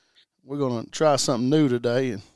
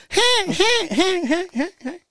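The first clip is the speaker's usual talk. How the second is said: high falsetto duck call imitation. Imitating calling ducks.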